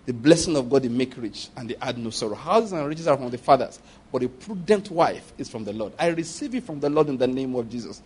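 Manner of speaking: low, soft prayerful declaration; quiet prayer. Retold or imitated. Preacher voicing a man's quiet declaration over his marriage. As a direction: pray it softly, with conviction.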